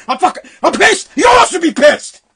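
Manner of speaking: high, loud furious shouting; pissed off. Real, not acted. Ranter urging listeners to share his outrage, furious shout. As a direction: yell it as a real outburst.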